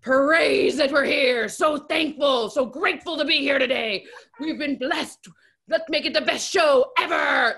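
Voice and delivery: loud, slow, fervent pump-up speech; booming blessed-and-grateful pep talk; Michael Irvin impression. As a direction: preach it like a hype man before the show. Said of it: "you do an incredible Michael Irvin impression".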